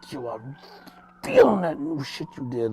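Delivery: intense, spitting mock praise; Headquarters, forceful. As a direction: imitate a friend's forceful hype. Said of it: "but that's how he talks".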